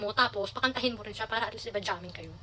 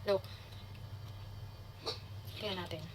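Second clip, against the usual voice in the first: normal talking voice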